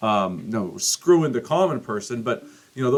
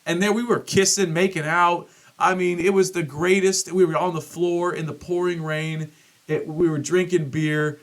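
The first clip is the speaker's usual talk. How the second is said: excited; 7 semitones higher than usual. Real, not acted. Excited memory of concert kissing.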